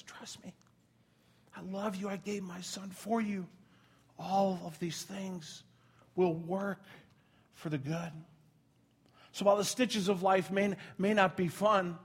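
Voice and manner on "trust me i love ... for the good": barely voiced, slow and tender; hushed reassurance. God the father reassures his child in a hushed whisper. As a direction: breathe the promise softly, close in.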